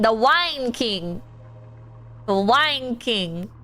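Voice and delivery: very slow, drawn-out name; slow dreamy repeat of the Wine King; savouring slowly. Real, not acted. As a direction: stretch each word out lovingly.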